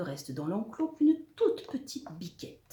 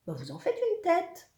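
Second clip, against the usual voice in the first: high-pitched needling remark; put-on high creature voice; teasing monster; 10 semitones higher than usual. A narrator's reading. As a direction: tease your worried neighbour in a high voice. Monstre taquine son voisin.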